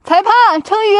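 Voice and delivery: high loud call to the referee. Real, not acted. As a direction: shout across to the judge. Calling the referee over.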